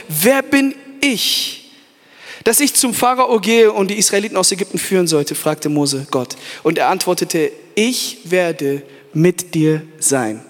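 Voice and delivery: low, slow, drawn-out reading; slow scripture reading. Acted. Preacher reads God's promise to Moses, slow and low. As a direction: let each word sink in.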